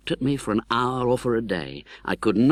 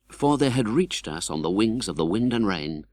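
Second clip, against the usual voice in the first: ordinary talk, nothing marked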